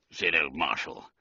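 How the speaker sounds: ordinary delivery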